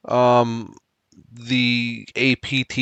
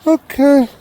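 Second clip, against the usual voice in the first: talking to a dog; 11 semitones higher, 4 dB louder than usual. Real, not acted.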